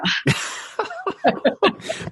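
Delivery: high unvoiced burst of laughter; breathy laugh. Real, not acted. Host laughing at story.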